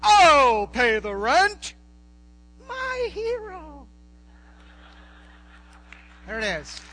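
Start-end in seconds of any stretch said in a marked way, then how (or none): 0.0-1.7 loud hammy hero, slow and bold; heroic rescuer. Acted. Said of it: "Bowtie Melodrama". 2.7-3.9 high, slow swooning falsetto; swooning damsel. Acted. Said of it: "Bowtie Melodrama".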